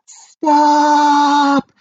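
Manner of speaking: high loud cry of stop; yell. Acted. Big Anthony yells at the bubbling pot, high and loud. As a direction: shout it at the pot. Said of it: "yelled Big Anthony".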